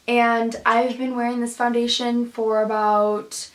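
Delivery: usual speaking voice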